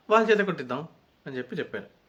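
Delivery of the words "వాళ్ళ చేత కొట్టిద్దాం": higher and louder, a playful retold boast; mock excited quip. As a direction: toss it off like an eager housemate. (ఉత్సాహంగా, పైస్థాయిలో, గట్టిగా అనుకరిస్తూ)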